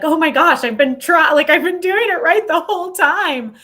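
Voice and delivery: excited relief. Retold or imitated. Reader shaking the book, thrilled she was right all along. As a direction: burst out with delighted vindication. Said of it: "just kind of shaking the book".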